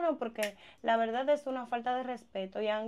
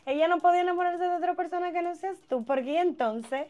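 scandalised outburst; 6 semitones higher, 6 dB louder than usual. Acted. Friend outraged at revelation.

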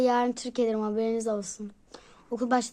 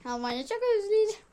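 excited; 9 semitones higher, faster than usual. Real, not acted. Sudden wistful exclamation.

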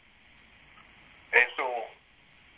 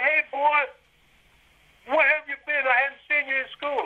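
higher, lightly voiced older man calling out; retold greeting; 10 semitones higher than usual. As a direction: imitate the equipment manager hailing a student, pitched higher. Equipment manager greeting him.

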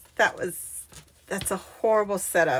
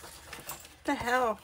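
soft, slow mutter to herself; muttered puzzlement; 2 semitones higher, 9 dB quieter, slower than usual. Real, not acted. Crafter puzzled by an odd measurement, muttered puzzlement. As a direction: let it out quietly, drawn out.

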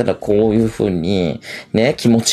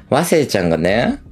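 ordinary talk

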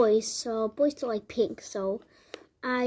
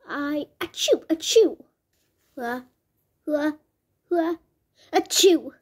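fake sneezes; 4 dB louder than usual. Mom sneezing from allergy.

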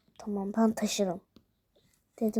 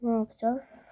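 whispered. Acted. Night whispering in bed.

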